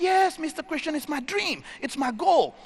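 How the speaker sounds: high-pitched, eager retold yes; eager declaration. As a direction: imitate an earnest dreamer insisting. Alex declares his dream, retold.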